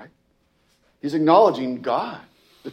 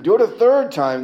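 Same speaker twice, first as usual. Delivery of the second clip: high, loud order; commanding call; 9 semitones higher, 8 dB louder than usual. Acted. Elijah ordering the people, loud commanding call. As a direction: bark the command boldly.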